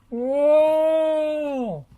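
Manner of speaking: very high, loud cat howl; startled howl. Acted. Pilchard howling after a hedgehog pokes her nose. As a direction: howl as you leap away. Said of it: "howled Pilchard".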